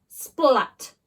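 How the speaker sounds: ordinary delivery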